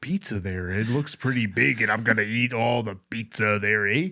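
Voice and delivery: folksy Canadian, lightly voiced; Canadian accent. Podcast host asked to talk Canadian, light Canadian accent. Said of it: "every time I talk Canadian".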